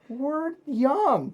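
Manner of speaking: high laughing protest; giggling squeal of an excuse. Real, not acted. Laughing defence of bar life.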